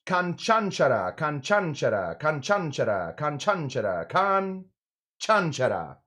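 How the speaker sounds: "Canchanchara" repeated slowly, syllable by syllable, KAN-chan-CHA-ra; say it five times. Shown as a demonstration. Host drilling the cocktail name for chat, slow repeated pronunciation. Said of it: "say it five times".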